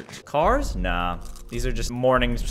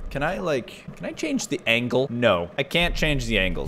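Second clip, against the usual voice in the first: ordinary talk, nothing marked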